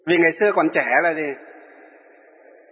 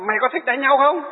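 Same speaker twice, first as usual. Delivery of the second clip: higher, mock-aggressive dare to fight; mock challenge; 8 semitones higher than usual. Retelling the villager daring the monk to fight, pitched-up mock taunt. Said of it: "Nó bảo".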